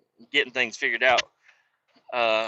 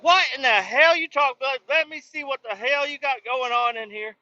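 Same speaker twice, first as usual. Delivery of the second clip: high-pitched imitation of a feisty coworker; mock-indignant, pitched way up; play-fight challenge; 12 semitones higher, 3 dB louder than usual. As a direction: squawk it like a pretend argument. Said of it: "fake play fight with me".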